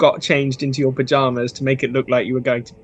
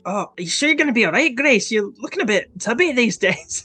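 put-on teasing boy voice; 6 semitones higher, faster than usual. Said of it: "Donnie's like".

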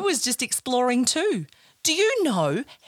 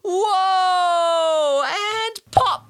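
louder, higher gleeful whoa then pop; kids' host cheering the pop; playful excitement; 7 semitones higher, 4 dB louder, much slower than usual. Acted. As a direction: act thrilled as the bubble bursts. Bubble grows then bursts.

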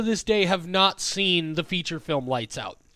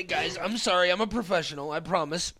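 ordinary talk, nothing marked

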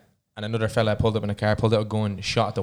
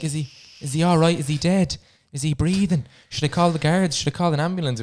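higher-pitched, fretting string of questions; retold concern in a raised pitch; worried lady bystander; 5 semitones higher than usual. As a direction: imitate an anxious woman asking for help.

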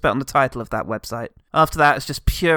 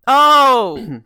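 ordinary talk

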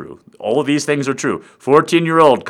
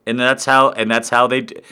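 ordinary delivery

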